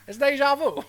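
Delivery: put-on high, loud Brooklyn accent. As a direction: say it like a Brooklyn cabbie. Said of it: "Brooklyn accent for a second".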